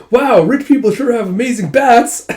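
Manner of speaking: high, loud delighted exclaim; excited gush. Retold or imitated. Dragons admiring the rich bath, delighted.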